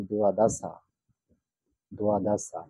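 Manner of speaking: দোয়াদাসা with do-wa and a hard s, not দ্বাদশ; Pali pronunciation. Shown as a demonstration. Teacher showing students the Pali word দোয়াদাসা. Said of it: "বাংলা যেটা বারো".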